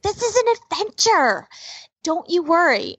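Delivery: high, put-on upbeat mom voice; mother reassuring child. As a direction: sell it to a scared kid as fun. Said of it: "I keep going like".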